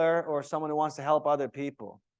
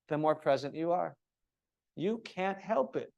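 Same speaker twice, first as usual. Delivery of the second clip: ordinary delivery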